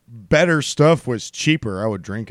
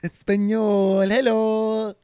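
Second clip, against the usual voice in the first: put-on Spanish accent; 7 semitones higher, 3 dB quieter, slower than usual. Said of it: "they're all the same impression".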